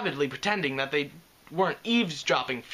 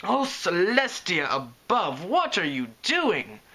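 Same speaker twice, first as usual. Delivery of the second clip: shriek; 3 semitones higher, slower than usual. Acted. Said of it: "The shriek came from".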